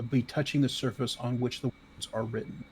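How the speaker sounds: usual speaking voice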